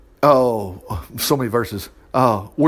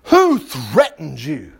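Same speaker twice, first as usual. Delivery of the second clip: slow, drawn-out royal demand; furious king; 5 semitones lower, much slower than usual. Acted. King demanding who threatened Esther, slow outrage. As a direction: demand a name, drawing out each word. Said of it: "Don't you know the king's like".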